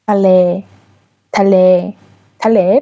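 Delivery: تله said in Thai with a long vowel; Thai word demo. Shown as a demonstration. Teacher models a Thai word for students. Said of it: "به معنای دریا".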